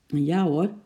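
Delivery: ordinary delivery, nothing marked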